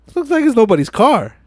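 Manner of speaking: loud, high-pitched mock declaration; acted taunt. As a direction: imitate a showy, taunting rival. Voicing Johnny Tran taunting them.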